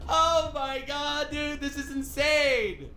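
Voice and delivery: slow disbelief. Real, not acted. Drawn out disbelief.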